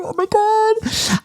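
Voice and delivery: very high, slow, squealed oh my God; excited squeal. Acted. Gushing to viewers about tomorrow's picnic with Amber, giddy high squeal.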